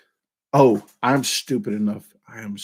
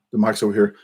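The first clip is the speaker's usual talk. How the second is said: ordinary delivery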